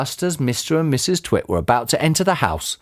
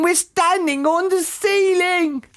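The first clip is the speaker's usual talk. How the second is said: very high, slow panicked wail; character's scream; slower than usual. A narrator's reading. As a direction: shriek it in horror, drawn out. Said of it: "screamed Missus Twit".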